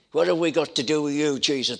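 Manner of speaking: loud, fast shouted cry; preacher's loud retelling of a demon's cry; demoniac shouting. Retold or imitated. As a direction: shout it like the tormented man. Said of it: "he's shouting".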